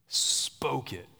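breathy whisper for effect. Real, not acted. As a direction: whisper it for drama. Dramatic pause in sermon.

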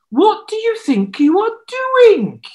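high, loud outraged rebuke; scolding prophet. Acted. Elijah rebuking the king, outraged. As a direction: scold him sharply.

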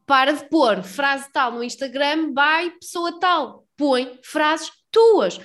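very slow, emphatic advice; slow and deliberate. Retold or imitated. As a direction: spell it out slowly. Retold lecture, very slow.

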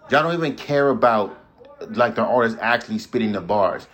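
ordinary talk

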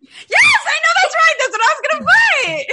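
very high, loud burst of recognition; excited shriek. Real, not acted. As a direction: shriek it out in thrilled agreement. Recognizing her catchphrase, shrieks in agreement.

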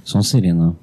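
loud low announcement of the house; loud, low hat verdict for the friend; mock sorting hat.

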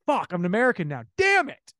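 outburst ending high and loud; mock frustration. Actor realising he lost his accent, mock angry outburst. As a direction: curse in hammed-up exasperation.

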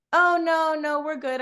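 high-pitched brush-off; imitated polite refusal. Acts out the girls' polite refusal.

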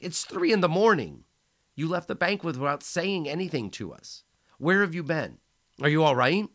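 frantic worry down the phone; startled friend, voice shooting up in alarm. A narrator's reading. Worried friend questions him.

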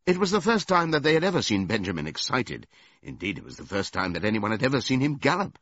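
plain reading voice

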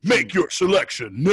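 loud game menu announcer; fighting game announcer. Pretend. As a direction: announce it like an arcade screen. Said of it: "game menu voice".

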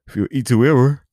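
words stretched by a loud yawn. Real, not acted. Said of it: "Too many yawns".

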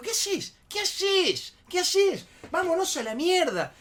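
very high, loud, frantic protest; retold panicked shouting; alarmed yelling. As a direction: shout to pull a friend away. Breaking up a beating.

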